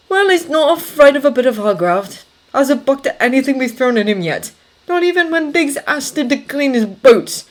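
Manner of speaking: lightly voiced plainspoken corporal; Jessup's voice. Narrator voices Jessup's dialogue.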